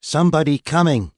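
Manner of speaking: high synthetic translator, tense. Acted. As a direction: machine voice warning of danger. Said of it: "in what sounded like a hoarse whisper".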